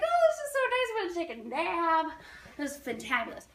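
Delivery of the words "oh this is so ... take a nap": high, gushing contentment; sing-song delight at roomy comfort; mock delight. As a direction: sigh it out high and blissful. Acted delight at the empty plane.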